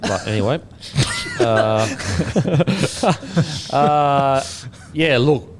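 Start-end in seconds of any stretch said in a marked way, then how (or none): 0.8-1.3 high-pitched burst of laughter; short, high giggle. Real, not acted. Laughing at own silly accent. 1.9-3.7 sustained high laugh, falling away; helpless laugh at yourself. Real, not acted. Laughing at own silly accent.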